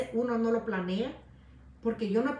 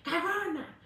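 mock taunt; 6 semitones higher than usual. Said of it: "que le llamen".

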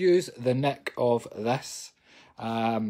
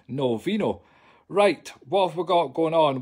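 normal talking voice

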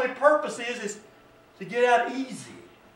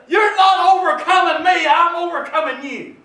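raised, defiant preacher's voice; defiant declaration; 7 semitones higher, 8 dB louder than usual. Acted. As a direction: stand up to the enemy out loud. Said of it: "And say".

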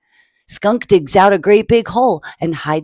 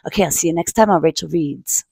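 usual speaking voice

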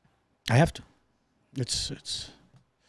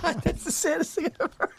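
mock crying; 11 semitones higher, 5 dB quieter than usual. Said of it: "I'm gonna cry".

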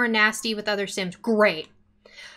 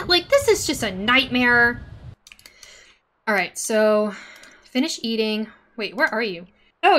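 ordinary delivery

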